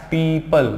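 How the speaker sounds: "पीपल" as "pee-pal"; broken into syllables. Shown as a demonstration. Teacher showing how to read it, "पीपल" in two parts. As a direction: stretch "pee", then add "pal". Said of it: "यहाँ पर इसको break कर दो".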